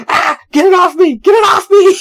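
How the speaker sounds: yelling in disgust to get the sheets off; freaking out. Retold or imitated. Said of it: "And Beavis freaks out".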